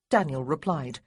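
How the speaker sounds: ordinary delivery, nothing marked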